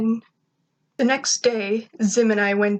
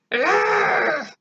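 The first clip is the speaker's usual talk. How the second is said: much higher, strained frustrated yell; frustrated scream; 10 semitones higher than usual. A narrator's reading. Zim fed up with Gur rolling around, frustrated scream. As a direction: let out an exasperated alien shriek. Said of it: "I heard Zim scream in frustration".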